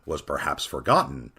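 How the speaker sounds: usual speaking voice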